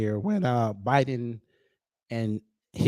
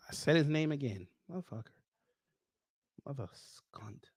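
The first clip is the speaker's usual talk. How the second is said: cursing under the breath, trailing very soft; muttered self-reproach; 12 dB quieter than usual. Real, not acted. Host cursing himself for saying that man's name, muttered self-reproach. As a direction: grumble it quietly to yourself.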